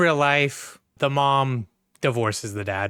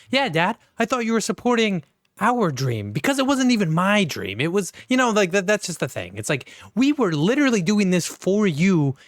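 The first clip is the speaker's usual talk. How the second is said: higher-pitched exasperated protest; indignant son; 7 semitones higher than usual. Retold or imitated. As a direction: argue back at Dad like a hurt son. Son arguing back at father.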